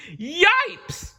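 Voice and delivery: very high loud yelp; scared cry. A narrator's reading. Kids seeing Miss Swamp arrive, a high frightened yelp. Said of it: "cried the kids".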